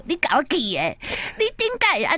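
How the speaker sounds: 台语八点档的夸张演法，尾句拔高; 学乡土剧演员夸张地喊出来; 八点档腔. Retold or imitated. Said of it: "我们的八点档很喜欢用说". (八点档连续剧式的夸张台语腔)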